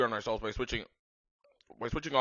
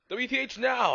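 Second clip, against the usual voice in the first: mock scream; 12 semitones higher, 6 dB louder than usual. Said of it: "I screamed".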